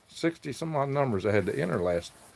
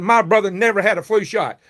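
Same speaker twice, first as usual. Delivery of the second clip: retold retort; 7 semitones higher, 6 dB louder than usual. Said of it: "I said".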